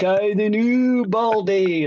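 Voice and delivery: slow, high mock greeting; imitating Dave's greeting. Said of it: "that's all he says".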